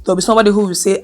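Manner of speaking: ordinary talk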